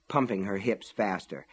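plain reading voice

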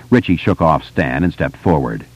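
ordinary delivery, nothing marked

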